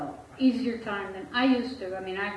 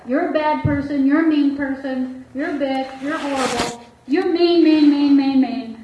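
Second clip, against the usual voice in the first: mimicking her rising, louder accusations, building to a shout on mean; quoted angry tirade; 4 semitones higher, 8 dB louder than usual. Therapist retelling his ex's tirade, imitating her growing anger. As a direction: throw her insults back as she hurled them.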